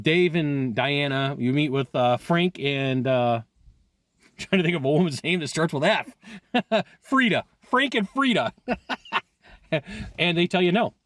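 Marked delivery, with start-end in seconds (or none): 3.9-8.7 laughing through the words while hunting for a name. Real, not acted. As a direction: chuckle as you search, pitch jumping up.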